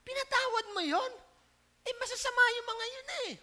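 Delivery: high-pitched whiny Jonah complaining; mock indignant Jonah. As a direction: play Jonah outraged at God's mercy. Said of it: "Sabi niya". (ginagayang galit na Jonah, mataas ang boses)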